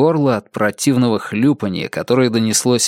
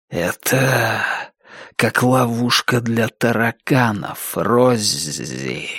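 eerie, hushed ghostly voice; disembodied whisper, drawing out the first and last words; whispering temple; slower than usual. A narrator's reading. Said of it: "прошептал храм".

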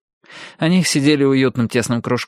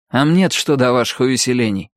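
ordinary delivery, nothing marked

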